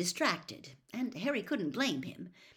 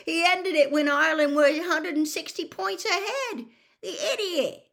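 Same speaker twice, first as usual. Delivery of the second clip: high, loud ranting protest; indignant shouting; exasperated bellow; 8 semitones higher, 7 dB louder than usual. A narrator's reading. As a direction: rail at the player over crowd noise. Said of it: "Ron bellowed".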